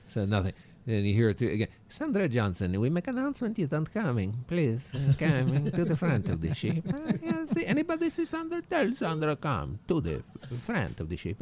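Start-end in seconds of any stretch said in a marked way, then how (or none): 1.9-11.4 broken-English captain, pitch a bit raised; mock Italian captain accent. Comic tells crowd how the captain made the announcement, mock Italian accent. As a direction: play the flustered Italian captain over the PA.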